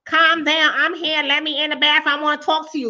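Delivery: hammed-up impatient helper at the door; pushy mock helper. Helper pushing at the bathroom door, insistent mock plea. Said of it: "knocking on the door talking about".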